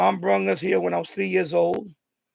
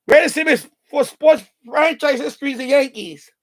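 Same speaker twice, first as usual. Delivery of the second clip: big proud fan proclamation, higher and louder; excited boast; 6 semitones higher, 6 dB louder than usual. Real, not acted. Proud Yankee fan to viewers, excited announcer-like boast. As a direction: trumpet it like a stadium announcer.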